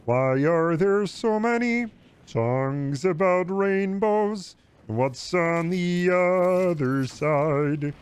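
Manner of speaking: very slow, put-on frog puppet voice; sing-song puppet musing, drawn out; Kermit impression. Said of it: "Sounds like Kermit".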